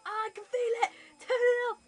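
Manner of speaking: high, loud hammed-up shout; mock shout. Imitating dad shouting loudly to turn the tens machine up. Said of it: "He was like".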